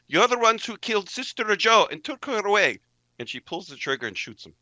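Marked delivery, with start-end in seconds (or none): 0.1-2.8 high, loud furious accusation, full sentence; accusing shout. Acted. Sister B accuses the killers of Sister Joe, shouting. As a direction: spit it out before pulling the trigger. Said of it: "She says".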